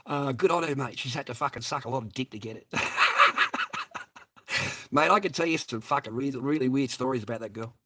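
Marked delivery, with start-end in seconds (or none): 2.7-4.2 high loud unvoiced shriek of laughter; wild squealing laugh. Real, not acted. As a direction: howl with laughter.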